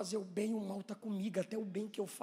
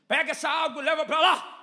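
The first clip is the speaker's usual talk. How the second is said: loud, higher barked command; slave owner's order; 8 semitones higher, 17 dB louder than usual. Acted. Slave owner sending his slave off with the water, barked order. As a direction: order a slave about loudly. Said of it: "Aí o escravocrata diz".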